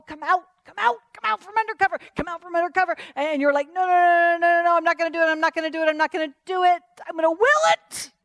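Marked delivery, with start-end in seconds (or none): 0.0-3.0 high-pitched, quick coaxing; playful tempting enemy voice; coaxing tempter. Acted. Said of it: "the enemy says". 3.7-8.1 loud, high-pitched insistent refusal; stubborn protest at raised pitch; defiant refusal. Acted. Acts out refusing the tempter.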